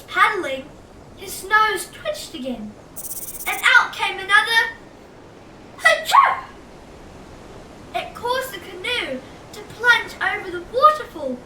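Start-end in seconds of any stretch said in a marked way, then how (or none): 5.8-6.4 high, loud fake sneeze. Reader sneezing as Sid in the story. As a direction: pop out a playful sneeze.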